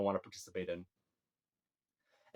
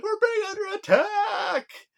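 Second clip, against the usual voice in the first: much higher, slow, shouted warning cry; alarm shout; 4 dB louder, slower than usual. Retold or imitated. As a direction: yell the alarm like a panicked lookout. Elves sound the alarm.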